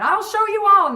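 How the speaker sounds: high, loud threat; mock scream. Tony Clifton yelling a threat on his way out, high loud. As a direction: yell a parting threat. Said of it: "screaming and screaming".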